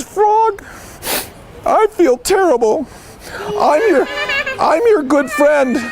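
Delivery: high, whimpering storybook apology; hammed-up wail of remorse; miserable. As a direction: own up to your friend, pitifully high. Toad confesses feeling terrible to Frog.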